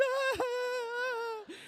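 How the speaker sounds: high, drawn-out mock wail; hormonal wail. Hormonal daughter trembling at the knees, mock wailing. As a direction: cry out in a long, high wail. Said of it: "I was hormonal and".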